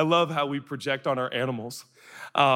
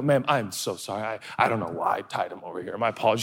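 low, contrite apology; lower, sheepish politeness; apologetic; 8 semitones lower, 3 dB quieter than usual. Acted. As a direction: apologize humbly to a stranger, voice dropped. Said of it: "So I, I go".

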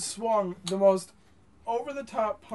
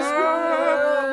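announcer voice; 6 semitones higher, 6 dB louder, slower than usual. Acted. Announcing the segment.

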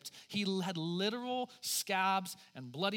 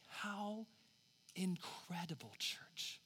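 hushed wonder; 8 dB quieter, much slower than usual. Acted. Whispered after God's words.

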